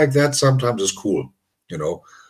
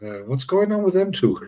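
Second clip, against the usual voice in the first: higher-pitched imitation of concerned onlookers; mock concern; 5 semitones higher than usual. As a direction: play worried viewers asking, lightly. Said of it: "concerned, like".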